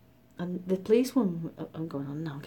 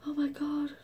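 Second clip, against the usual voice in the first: retold hysteria; 10 semitones higher than usual. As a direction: act out being beside yourself, pitched high. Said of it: "I was so hysterical".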